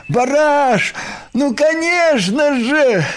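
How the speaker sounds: high, heartfelt shout to a friend; loud, emotional reassurance; calling out. A narrator's reading. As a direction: cry it out from behind, high and drawn-out. Krosh calls out from behind.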